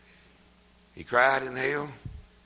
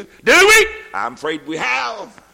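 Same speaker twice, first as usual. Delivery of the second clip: high, loud ringing sermon cry; preacher shouting the verse and a joyful woo; preaching at full force; 9 semitones higher, 13 dB louder than usual. Acted. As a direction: whoop and lift the verse to the rafters. Preaching climax.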